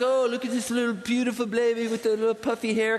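a light, gushing mock of a TV birth scene. Preacher mocks how TV shows newborns, lightly gushing. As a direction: coo over the baby the way television does. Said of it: "You see these things on T V".

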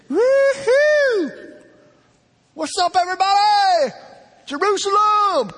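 very high, loud whoop to a crowd; mock triumphant greeting. Imagined Jesus greeting cheering Jerusalem, exuberant shout. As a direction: holler like a star hyping the arena.

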